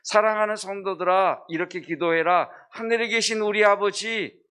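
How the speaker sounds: high, loud, solemn exhortation; preacher proclaims scripture's command, high and loud. Retold or imitated. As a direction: proclaim as the voice of God's word. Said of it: "성경은 우리에게 이렇게 이야기하죠".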